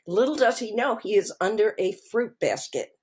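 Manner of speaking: ordinary talk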